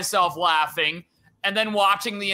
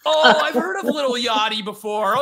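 normal talking voice

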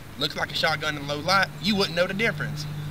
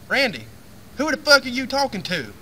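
lightly voiced wife, higher and sharper; mock wife, pitched up and annoyed; wife's voice; 7 semitones higher, 3 dB louder than usual. Wife catching husband talking to nobody, mock higher scold. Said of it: "she's like".

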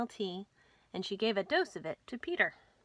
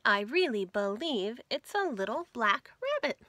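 louder, higher sing-song rhyme; cheerful louder rhyme reading; lively nursery rhyme; 3 semitones higher, 5 dB louder than usual. A narrator's reading. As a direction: bounce through the rhyme for children.

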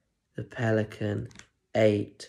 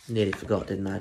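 usual speaking voice

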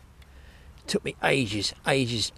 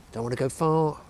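ordinary talk, nothing marked

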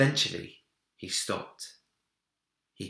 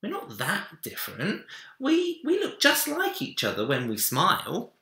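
put-on higher child voice, rising eagerly; pleading boy; 11 semitones higher, 3 dB louder than usual. A narrator's reading. Minik insists to Big Bear they look alike, high and eager. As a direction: argue it like a keen little boy. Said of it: "said Minik".

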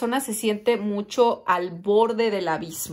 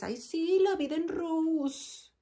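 high, soft, slow sarcastic swoon; mock their rosy facade; mocking dreamy tone; 5 semitones higher, 6 dB quieter, slower than usual. Reader mocking someone's perfect photos, high soft sarcasm.

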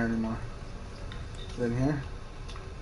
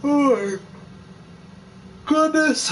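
talking through a yawn; high yawning stretched words; 14 semitones higher, 3 dB louder, slower than usual. Real, not acted. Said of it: "I'm yawning".

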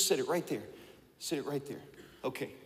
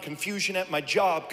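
ordinary delivery

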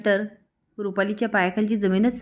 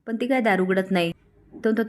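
ordinary delivery, nothing marked

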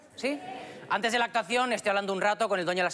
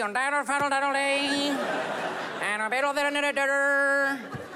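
high, very slow slurred mock English; drunk grandpa as Bush; 4 semitones higher, much slower than usual. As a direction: slur it like a tipsy grandpa back from the bar. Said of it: "mi abuelo volvía del bar".

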